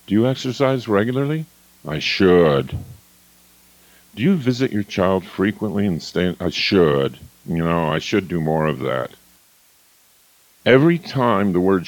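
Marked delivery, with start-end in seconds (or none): 1.9-2.8 lower, falling; deflated should. Shown as a demonstration. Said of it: "listen for the voice tone". 6.4-9.1 low falling mumble; guilty should. Shown as a demonstration. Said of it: "listen for the voice tone".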